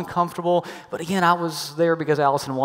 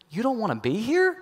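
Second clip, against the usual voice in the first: sad question; 5 semitones higher, 5 dB quieter than usual. Retold or imitated. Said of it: "really sad expression".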